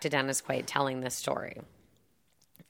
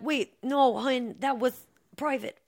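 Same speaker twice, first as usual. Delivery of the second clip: flustered husband; 9 semitones higher than usual. Retold or imitated. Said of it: "he's like".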